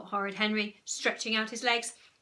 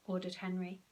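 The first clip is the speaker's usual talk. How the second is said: plain reading voice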